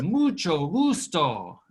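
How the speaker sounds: "Mucho gusto" drawn out slowly, stress on "mucho". Shown as a demonstration. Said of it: "Very good".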